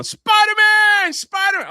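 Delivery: high, loud drawn-out cheer; cheering shout. Retold or imitated. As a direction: yell it like a ride cheer. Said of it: "So I just start going".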